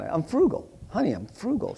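high, coaxing plea to a wife; making it sound nice. Retold or imitated. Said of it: "I try to make it sound".

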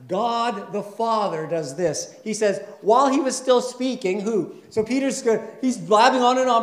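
loud, high preaching climax; full-force preaching. Acted. Pastor preaching loudly to the congregation, full force. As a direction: drive the passage home with full voice.

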